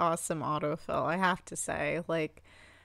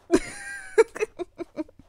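squealing high laugh of delight; 5 dB louder than usual. Real, not acted. Overjoyed at villager.

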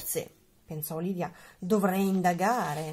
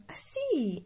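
usual speaking voice

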